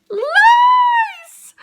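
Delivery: very high, loud, stretched squeal; horrified reveal. A narrator's reading. Storyteller reveals what came from the dirt, horrified squeal. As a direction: squeal the word in mock horror.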